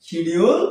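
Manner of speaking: শিডিউল said "shi-di-ul"; short i and clear d in শিডিউল; wrong pronunciation. Shown as a demonstration. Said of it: "কিন্তু কখনো এটা".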